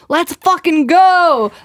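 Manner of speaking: high, drawn-out battle cry; hyped-up yell. Acted. Host voicing fired-up Aries placements to her co-host, hyped-up yell. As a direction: yell it slowly, stretching the last word. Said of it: "Stop yelling at me".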